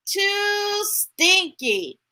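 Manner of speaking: slow, drawn-out whine of too stinky; fussy refusal, slowed right down; picky lion. Acted. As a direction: stretch it out like a fussy eater. Character refusing.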